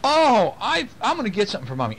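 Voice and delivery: put-on high, quick little girl voice; little girl's voice. Dad voicing his daughter helping tired mom, high and quick. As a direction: play the eager little daughter. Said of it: "And so she said".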